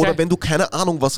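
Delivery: normal talking voice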